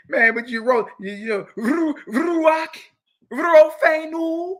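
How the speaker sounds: Ruach and Rofenu with an exaggerated rolled R, high and loud; hammed-up rolled R in Ruach, mocking the new Torah crowd; mock rolled Hebrew R. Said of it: "you're rolling your reshes now".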